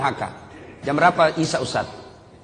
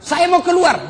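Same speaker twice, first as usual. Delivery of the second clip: high-pitched acted protest; mock defiant outburst, pitched up; defiant son; 12 semitones higher, 4 dB louder, slower than usual. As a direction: imitate a son insisting against his mother. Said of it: "Dilarang sama ibunya. Marah ini anak".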